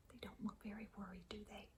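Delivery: soft hushed storytelling; whisper. Real, not acted. Reader whispering to the children about the parents. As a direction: drop to a whisper.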